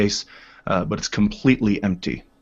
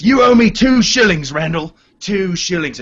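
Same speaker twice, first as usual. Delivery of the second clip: high, loud crowing over a bet; gloating; 9 semitones higher, 6 dB louder than usual. Acted. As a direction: rub the win in his face. Orin gloats to friend Randall.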